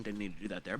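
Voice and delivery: ordinary talk, nothing marked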